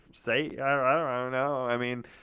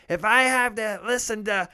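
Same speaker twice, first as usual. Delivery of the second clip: much higher, louder exasperated outburst; fed-up mom; 12 semitones higher, 8 dB louder than usual. Retold or imitated. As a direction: snap like a mother at the end of her rope. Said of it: "the sweetest angel ... goes".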